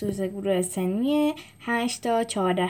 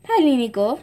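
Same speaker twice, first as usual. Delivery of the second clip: usual speaking voice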